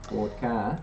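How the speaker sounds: Australian accent. Shown as a demonstration. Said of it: "That's how I have to remind myself".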